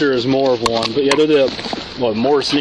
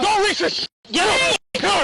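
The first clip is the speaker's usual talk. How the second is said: high, tense shouted orders; shouting. Real, not acted. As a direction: scream the commands. Officer orders woman out.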